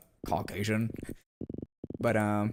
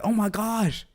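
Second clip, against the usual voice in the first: much higher, louder, gushing mock auntie voice; excited Vietnamese aunties; 11 semitones higher, 5 dB louder than usual. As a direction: squeal like a thrilled auntie meeting a compatriot. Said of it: "they're like".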